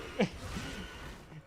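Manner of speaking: breathless squealing laugh. Real, not acted. Cracking up at the impression.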